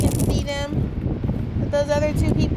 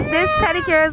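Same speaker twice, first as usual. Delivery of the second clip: ordinary delivery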